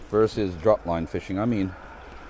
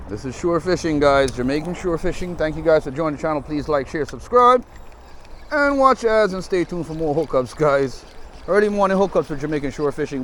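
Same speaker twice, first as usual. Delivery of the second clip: upbeat, higher channel outro; vlog sign-off; 6 semitones higher, 4 dB louder than usual. Real, not acted. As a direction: pump up the sign-off.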